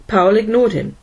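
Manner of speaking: plain reading voice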